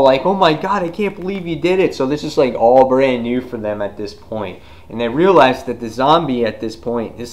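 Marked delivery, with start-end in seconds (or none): 0.2-1.9 high, excited imitation; amazed mock gushing. Group praises the rescuer, high mock amazement. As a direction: gush in mock disbelief, pitched up.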